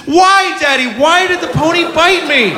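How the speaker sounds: put-on high, pleading child voice; upset little girl. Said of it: "she says".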